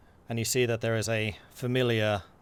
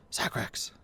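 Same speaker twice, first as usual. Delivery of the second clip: whispered from the shadows; breathy unseen whisper; dark whisper behind you; 8 dB quieter than usual. Acted. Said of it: "calls out quietly".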